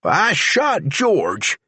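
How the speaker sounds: deputy telling the sheriff; Charlie's voice. A narrator's reading. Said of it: "Charlie said".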